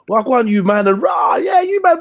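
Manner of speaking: hammed-up friendly greeting. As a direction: greet them with exaggerated gusto, pitch climbing. Acts out greeting rivals to disarm.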